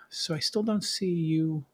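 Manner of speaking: normal talking voice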